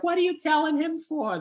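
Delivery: very high put-on woman's voice, scolding; wife chiding husband, very high falsetto. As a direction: squeak it out like the embarrassed wife. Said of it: "she's like".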